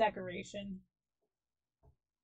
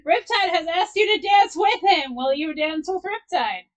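loud, high, hammed-up shout; mock proclamation; 7 semitones higher, 13 dB louder than usual. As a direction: proclaim it to the whole crowd, playfully. Mock proclamation of a dance request.

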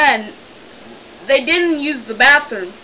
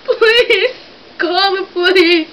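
fake pleading; 6 semitones higher, slower than usual. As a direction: beg down the phone like it's life or death. Prank caller fakes an emergency.

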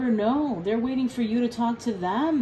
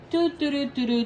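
higher, louder, bouncy doo-doo drumming sound; bored finger-drumming hum; impatient tapping hum; 6 semitones higher, 4 dB louder than usual. Retold or imitated. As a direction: hum like fingers drumming on a desk while waiting. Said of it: "tapping their hands on the fucking desk".

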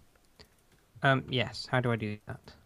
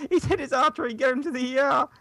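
mock panic; 12 semitones higher, 5 dB louder than usual. Mock emergency panic play.

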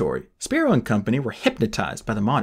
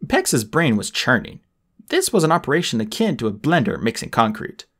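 ordinary delivery

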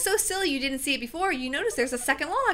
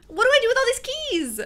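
higher, louder frustrated cry; exasperated outburst; 10 semitones higher, 6 dB louder than usual. Real, not acted. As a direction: throw the question up in exasperation.

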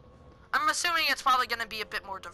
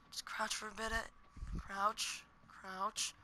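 very quiet hushed voice; 3 semitones lower, 14 dB quieter, slower than usual. Real, not acted. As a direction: keep it down while hiding. Whispering while hiding.

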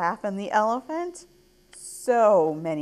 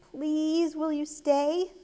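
put-on high, pleading child voice; earnest storybook plea; begging; 7 semitones higher, slower than usual. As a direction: plead with them to stay. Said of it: "begged Ava".

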